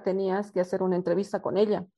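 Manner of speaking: normal talking voice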